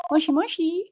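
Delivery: high, lilting mock phone pickup; playful phone greeting. Playfully answers a phone call.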